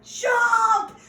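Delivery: high, loud yelled command; shouted order. Retold or imitated. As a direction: bark the warning at her. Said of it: "And he's like".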